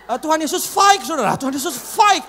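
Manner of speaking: high, loud shout with "baik" turned to "faik"; shouted "baik" leaking air as "faik"; false teeth gone. Retold or imitated. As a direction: say "baik" with an f, like a toothless preacher. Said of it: "Jadi udah bukan baik lagi".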